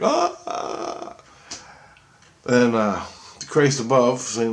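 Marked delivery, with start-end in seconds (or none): 0.0-1.2 very high drawn-out wail; mock frightened cry. Speaker imitating Christs freaking out and running away, mock frightened cry. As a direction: imitate a panicked "aah", held high. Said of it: "they're crying and running away and they're like".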